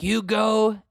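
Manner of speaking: high, loud cheer of support; mock cheering on. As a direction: cheer her on like a TV fan. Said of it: "And I'm like".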